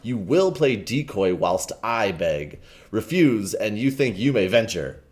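ordinary delivery

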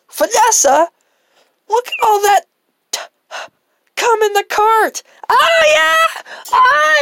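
high, loud whiny customer voice; high squeaky customer. Acted. Customer character voice.

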